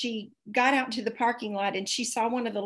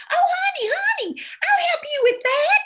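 high, gushing southern-lady voice, louder; sugary high "oh honey" lady voice; sweet helpful lady; 6 dB louder than usual. Retold or imitated. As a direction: coo it up high like a doting stranger. Sweet stranger offering help, voiced.

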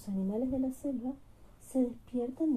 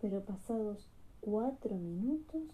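ordinary delivery, nothing marked

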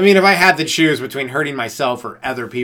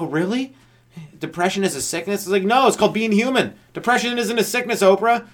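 sarcastic retort; 6 semitones higher than usual. Acted. Arguing with Oprah.

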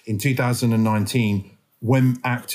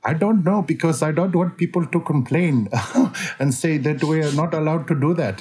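higher-pitched put-on Indian accent; 5 semitones higher than usual. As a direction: slip into an imitated Indian accent. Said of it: "I tend to slip into Indian".

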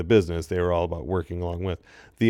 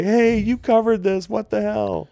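much higher, outraged fan voice; indignant complaint; 13 semitones higher than usual. Retold or imitated. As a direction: protest like an angry young fan. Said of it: "I wanted to send it in to them and say".

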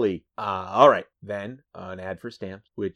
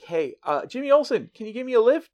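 high, acted hail; calling out; 13 semitones higher, 4 dB louder than usual. Rival editor asking Jimmy for a lift, calling out. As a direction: call over in a raised pitch.

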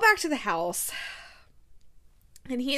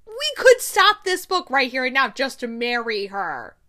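louder, emphatic complaint; exasperated insistence; 8 dB louder than usual. Real, not acted. Vlogger pleading for the story to end, exasperated. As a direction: drive it home loudly. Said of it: "exasperated conclusion".

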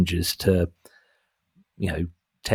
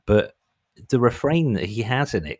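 normal talking voice

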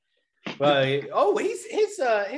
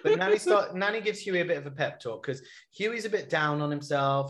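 ordinary talk, nothing marked

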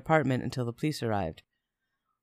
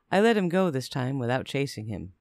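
ordinary delivery

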